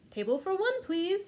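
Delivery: high, polite playful voice; grandma playing along. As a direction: play the customer politely. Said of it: "said Grandma".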